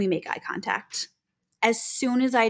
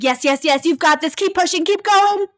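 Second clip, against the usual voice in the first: loud coach-like cheering, rising higher and higher; hyping up; 8 semitones higher, 7 dB louder than usual. Shown as a demonstration. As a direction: hype up a patient through a push, building to a peak. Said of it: "I'm hyping you up".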